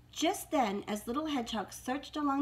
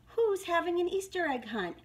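high, lively storybook question; eager hedgehog, pitched up; excited cry; 7 semitones higher, 4 dB louder than usual. A narrator's reading. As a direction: call it out with excitement. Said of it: "he cried".

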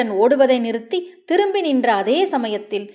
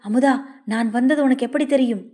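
usual speaking voice

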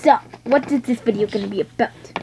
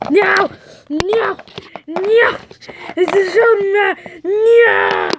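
wailing no; 8 semitones higher, much slower than usual. Acted. Character wailing over the lie, high crying no. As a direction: wail it out in despair. Acted despair, crying heard.

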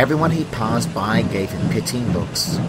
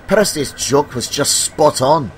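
high, loud, amused character voice; teasing engine; 7 semitones higher, 5 dB louder than usual. Acted. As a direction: tease in a high, lifted voice. Emily teases James in story.

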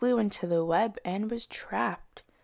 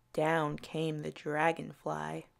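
usual speaking voice

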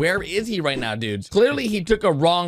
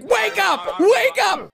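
imitated yell; 12 semitones higher, much slower than usual. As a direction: shout it the way Red would. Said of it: "Red's like".

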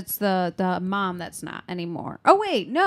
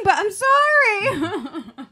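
high, slow apology through laughter; laughing; 12 semitones higher, 8 dB louder, much slower than usual. Real, not acted. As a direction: say sorry while cracking up. Laughing apology after mistake.

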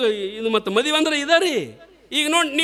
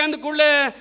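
usual speaking voice

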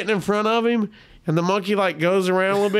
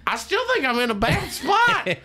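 higher, comic insistence; high-pitched protest; 7 semitones higher than usual. Retold or imitated. Man pinned by a tiny monkey insisting, high mock alarm.

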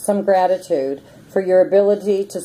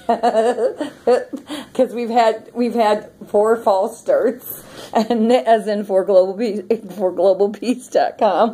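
laughing while talking; 3 semitones higher, slower than usual. Real, not acted. Laughing through a story.

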